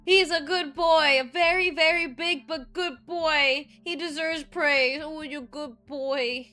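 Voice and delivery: put-on drawn-out cooing good-boy voice; doggy baby talk. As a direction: praise a big dog lavishly. Praising werewolf like a dog.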